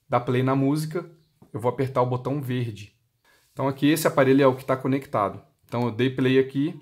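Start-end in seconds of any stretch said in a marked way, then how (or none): none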